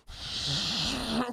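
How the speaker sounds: imitated mad exhale; wordless cross sigh; angry huff. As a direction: huff out a breath in mock anger. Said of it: "she's mad".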